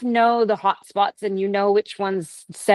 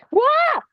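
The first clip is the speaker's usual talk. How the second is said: playful squeal. Real, not acted. Squishing a candy.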